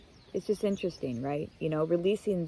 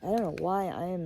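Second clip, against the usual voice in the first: usual speaking voice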